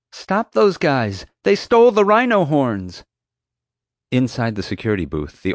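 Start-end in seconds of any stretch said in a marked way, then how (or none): 0.1-3.1 high, loud, drawn-out desperate shout; desperate yell. Acted. Boy yelling to a distant guard, desperate shout. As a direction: yell for help with no hope of being heard. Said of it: "I yelled to him out of desperation".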